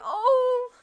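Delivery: loud, high "oh" of dismay; acted cry of concern; sympathetic cry. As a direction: cry out in pitying alarm. Jin sees Vin's hurt nose.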